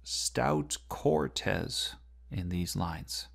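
Stout Cortez, both words weighted, slow; stressing stout Cortez; slow stressed reading. Shown as a demonstration. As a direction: lean slowly on Stout and Cortez. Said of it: "something similar with stout Cortez".

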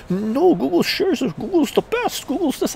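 mocking the friend who defends Google. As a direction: parody the friend's praise.

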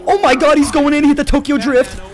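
excited shout. Real, not acted. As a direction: cheer him on as he charges. Teammate charging in.

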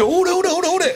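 high, stuttering panicked voice; imitating a frazzled partner; flustered stammer. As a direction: stumble then blurt yes. Partner flustered, repeating himself.

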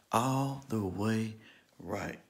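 "All the way right" drawn out slowly, word by word; slow, spaced-out "All the way right" for emphasis; slow emphatic repeat. Retold or imitated.

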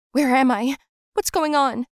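high, loud frightened cry; kidnapped girl crying out in panic; panicked waking. Acted. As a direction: wake up terrified. Waking in dark room.

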